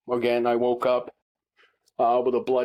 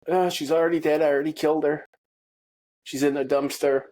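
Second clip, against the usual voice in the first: imitated woman caller; 5 semitones higher, faster than usual. Voices the lady on the call.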